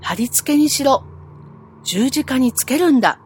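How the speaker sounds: storyteller voicing the crowd's shouted demand; crowd shouting. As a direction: shout it like a mob demanding death. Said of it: "大きい声で叫び続けます".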